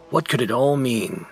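ordinary delivery, nothing marked

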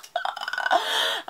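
squealing laugh up high. Real, not acted. Vlogger laughing after acting out an excited fan. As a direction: laugh at your own hype.

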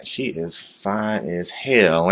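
normal talking voice

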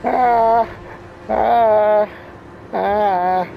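real fright building to screams; panicked screaming. Real, not acted. Monster finds him, panics.

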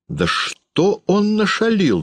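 high, drawn-out demanding question; narrated exasperated questioning; interrogating father. A narrator's reading. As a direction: press for an answer, slowly and high. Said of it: "допрашивал отец".

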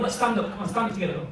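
normal talking voice

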